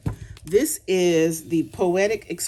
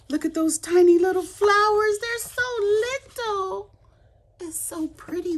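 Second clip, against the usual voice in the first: gushing squeal; 5 dB louder than usual. Real, not acted. Crafter gushing to viewers over tiny flowers, squealing.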